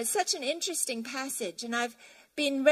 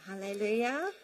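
usual speaking voice

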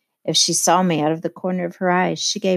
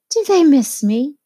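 higher and louder, thrilled; ecstatic cry; 8 semitones higher, 6 dB louder than usual. A narrator's reading. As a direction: burst out with delight. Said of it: "she cried ecstatically".

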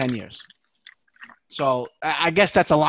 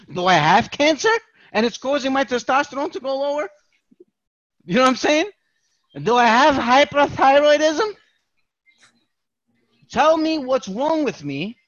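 higher, pressing worried questions; mock anxious pleading; 6 semitones higher than usual. Patient pressing the doctor for answers, mock anxious and higher. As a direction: pester the doctor with rising worry.

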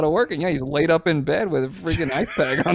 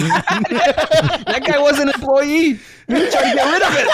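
slow, high, laughing joke; chuckling through the punchline; 8 semitones higher, slower than usual. Real, not acted. Joking with friends, slow high laughter.

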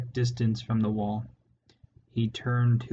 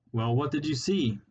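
impatient, irritable demand, a bit higher; irritable bark; 4 semitones higher than usual. A narrator's reading. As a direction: snap the question at them impatiently. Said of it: "barked Clooney irritably".

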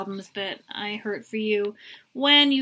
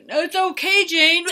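mock strained reassurance; 7 semitones higher, 6 dB louder than usual. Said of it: "And he's like".

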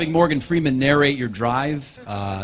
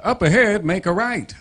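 Morgan Freeman; 4 semitones higher than usual. Acted. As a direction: narrate the directions like a documentary. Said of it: "it'll say things like".

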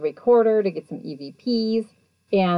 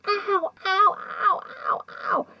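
high yelping ow's, much higher; retold cries of pain, high and breathy; mock pained yelps; slower than usual. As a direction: yelp in sudden pain, way up high. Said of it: "he starts yelling".

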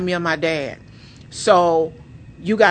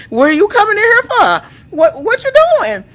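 high-pitched, loud, startled questioning; retold alarm; 13 semitones higher, 6 dB louder than usual. Wife to husband following her in, hammed-up alarm. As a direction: act out panic at being followed.

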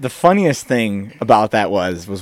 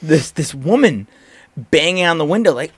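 normal talking voice

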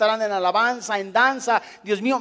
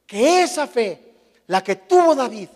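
ordinary talk, nothing marked

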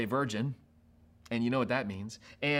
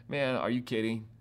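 dismissive; 4 semitones lower, 6 dB quieter than usual. Acted. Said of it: "you'd be like".

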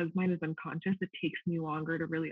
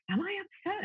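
retold surprised question, pitched way up; puzzled self-questioning. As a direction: query yourself in a high, baffled voice.

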